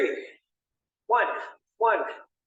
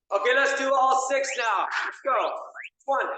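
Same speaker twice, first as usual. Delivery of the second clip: shouting; 6 semitones higher than usual. Real, not acted. Fitness instructor rallying class.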